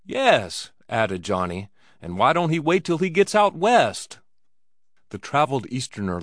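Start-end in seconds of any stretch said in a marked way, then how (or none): 0.0-0.7 high, excited chiming in; emphatic agreement. A narrator's reading. Johnny backing up the scandalized cowboy, excitedly emphatic. 2.0-4.2 high, loud protesting question; indignant question. A narrator's reading. Johnny backing up the cowboy's protest, indignantly questioning.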